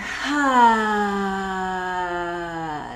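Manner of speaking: big slow sigh out; dramatic sigh. Shown as a demonstration. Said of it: "Nice big sigh".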